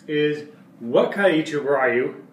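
ordinary talk

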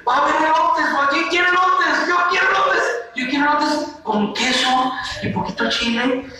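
high-pitched excited chatter; hammed-up happy excitement; acted joy. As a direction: clamour for a treat like an eager child. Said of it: "cuando estás alegre".

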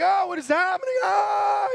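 high, loud alarmed shout in the crash; panicked yell. Retold or imitated. Driver mid-crash shouts in panic, loud and high. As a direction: caught off guard in his first wreck, yelling. First wreck, panicking in car.

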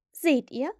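self-satisfied told-you-so; immodest. A narrator's reading. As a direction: gloat a little at being right. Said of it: "sagte Wendy unbescheiden".

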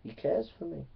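soft, steady no to the devil; hushed, trusting reply to the inner voice; firm quiet refusal. Retold or imitated. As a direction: quietly push the voice away, calm and sure. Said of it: "you say".

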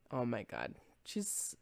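usual speaking voice

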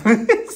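loud bright laugh; burst of laughter. Real, not acted. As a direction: laugh out at the joke. Laughs at own impression.

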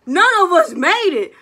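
high, loud disbelief; shocked outcry. Retold or imitated. Rejected singer cries out at the judge in disbelief. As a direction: throw it out in stunned disbelief.

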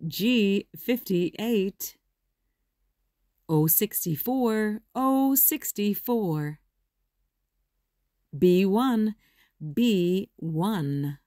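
normal talking voice

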